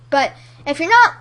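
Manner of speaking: normal talking voice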